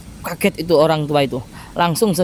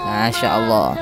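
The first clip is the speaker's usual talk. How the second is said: moved to tears; 9 semitones lower than usual. Real, not acted. Preacher moved by the retold gift.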